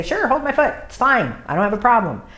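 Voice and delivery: high, breezy puppy voice saying sure; casual little puppy voice. Pretend. Voicing the puppy agreeing.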